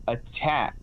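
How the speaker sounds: ordinary talk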